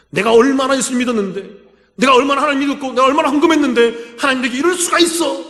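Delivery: mock whining believer; indignant complaint. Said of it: "라고 울고 앉아있는 잘못된 신앙인들처럼".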